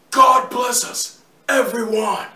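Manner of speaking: slow, loud, drawn-out Reeves take; Keanu Reeves impression. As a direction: lean into the slow Reeves delivery. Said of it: "Mister Reeves".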